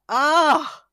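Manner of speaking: loud, high drawn-out "oh"; dismayed groan. Retold or imitated. Wife hearing of her mistake from husband, loud dismayed groan. Retold reaction to husband's bad news.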